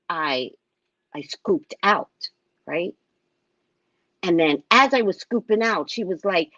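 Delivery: usual speaking voice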